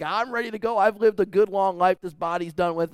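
put-on high, loud cheery old patient; a dying man greeting his pastor, cheerful and loud. Dying man to his pastor, loud and cheerful. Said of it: "where he's like".

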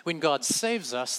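ordinary talk, nothing marked

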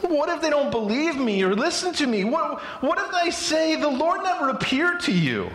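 high, pleading whine; whiny voice. Acted. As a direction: whine it like a reluctant hero. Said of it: "I want to give him a whiny voice".

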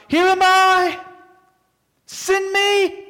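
high, ringing call to be sent; Isaiah eagerly volunteering to God, high ringing cry. Retold or imitated. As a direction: throw yourself forward, high and eager. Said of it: "cries out".

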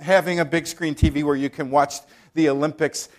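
ordinary delivery

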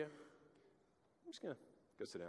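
a hushed slip away; whispered aside. Pretend. Preacher whispers Jesus slipping back to his seat. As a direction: whisper it, sneaking off.

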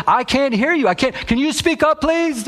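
very high mock complaint; hammed-up high-pitched demand to speak up; imitated interrupting woman. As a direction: cut in as the exasperated objector. Retold woman interrupting again.

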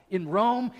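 surprised gossip hearing about the Roman church; surprised question. Retold or imitated. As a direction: ask "In Rome?" with the pitch climbing on Rome. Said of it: "they're talking like this".

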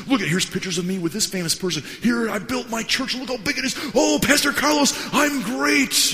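high, hammed-up bragging; boastful famous pastor. Famous pastor shows off his book, mock boastful. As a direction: crow about yourself, climbing to a loud shout.

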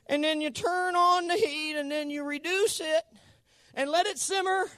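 high, drawn-out TV cook impression; hammed-up cookery host in falsetto, swelling toward the end; Julia Child. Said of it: "She kinda talks like this".

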